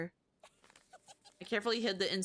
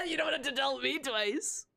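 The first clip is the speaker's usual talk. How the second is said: laughing; 5 semitones higher than usual. Acted. Fox girl laughs, accepts.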